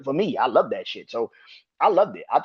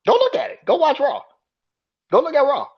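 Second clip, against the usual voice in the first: insistent rant; 13 semitones higher, 5 dB louder than usual. Real, not acted. Urging viewers angrily.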